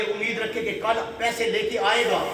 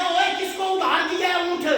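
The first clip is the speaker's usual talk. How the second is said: high, loud furious yell; angry shout; 11 semitones higher, 3 dB louder than usual. Acted. Camel owner yelling at his servants, furious and loud. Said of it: "اور غصے میں آ گیا".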